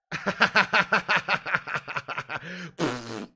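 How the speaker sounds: long, loud ha-ha-ha laugh; big staccato laugh. Pretend. Streamer answers a viewer's Arsenal question, big staccato laugh.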